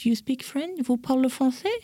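mock wonder, lifted pitch; impressed American. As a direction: play an American marvelling at French speakers. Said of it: "c'était des Américains".

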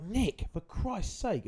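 high-pitched, drawn-out mock reproach; mock exasperated scolding. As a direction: scold a mate in a slow, high voice. Chiding a friend for swearing, retold.

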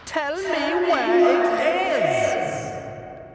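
high, slow, loud yell; shouting demand. Acted. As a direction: bellow the demand slowly. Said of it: "the mayor's voice called out".

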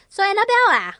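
high, prying auntie voice, Singlish lilt; nosy auntie. Retold or imitated. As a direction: pester her like a nosy auntie at New Year. Nosy aunties at New Year.

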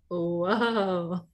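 old man with a bad hip, catching himself; startled creaky old man whoa. Shown as a demonstration. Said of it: "if I were old and had a raggedy hip".

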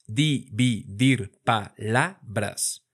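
"Dividir palabras" spoken slowly, one syllable at a time; slowly split into syllables. Shown as a demonstration. Teacher splitting a phrase into syllables for students. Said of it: "Vamos a practicar nuestra división silábica".

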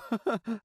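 quick high nervous laugh; short laugh. Acted. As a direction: chuckle before answering. Boy laughs before compliment.

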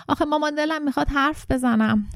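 put-on mild child's howl of complaint; childish whine. A narrator's reading. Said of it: "زوزه کشیدم".

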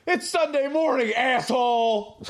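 high shout, drawing out the insult; imitated angry yell. Pissed runner yelling back at a driver, imitated high shout. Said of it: "from the race course yell".

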